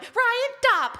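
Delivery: very high, loud squeaky echo; squeaky mock command; mocking mimicry of wife. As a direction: parrot the wife to tease her. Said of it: "And he went".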